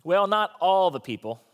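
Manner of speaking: normal talking voice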